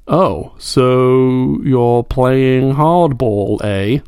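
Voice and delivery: very slow, drawn-out mock challenge. Host drafting a message live on air, slow mock challenge. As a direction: stretch it out like a sly dare. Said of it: "what I was kind of thinking of writing".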